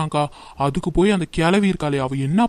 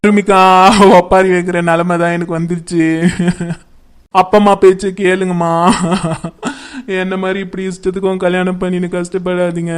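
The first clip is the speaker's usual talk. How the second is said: wailing lament; 4 dB louder than usual. Acted. Wife bewails her husband turning on her, wailing. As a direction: wail it like a funeral dirge. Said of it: "ஒப்பாரி வச்சிருக்கீங்க".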